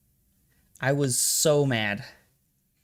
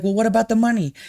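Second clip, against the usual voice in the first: mimicking the scammer; 9 semitones higher, 5 dB louder, faster than usual. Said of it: "And he's like".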